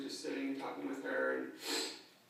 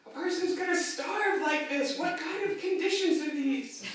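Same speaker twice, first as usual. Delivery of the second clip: high, put-on whiny old lady, drawn out; milk every complaint like a tragedy; mock outraged demand for food; dramatic starving grandma; 13 semitones higher, 6 dB louder than usual. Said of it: "on and on with the drama".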